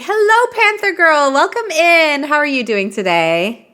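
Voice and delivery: excited greeting. Real, not acted. Greeting a viewer on stream.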